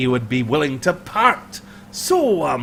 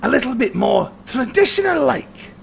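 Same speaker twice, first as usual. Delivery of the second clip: higher, sly clansman voice; high mocking Highlander; 9 semitones higher than usual. Highlander jokes to Hinder about the party, high sly voice. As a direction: tease the strangers in a raised voice.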